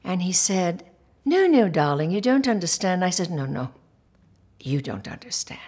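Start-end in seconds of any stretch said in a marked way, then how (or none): 1.3-3.0 louder imitated English accent, brushing her off; patronizing British director's correction. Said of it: "he's English". 3.3-5.6 low, quiet retold fury; contained rage. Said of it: "I was just trembling with rage".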